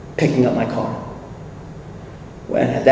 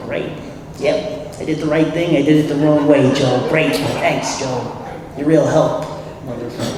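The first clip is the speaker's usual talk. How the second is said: sarcastic thanks; 4 dB quieter than usual. Retold or imitated. Answering Joe's unsympathetic verdict, sarcastic thanks. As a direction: thank him drily, dropping softer as it goes.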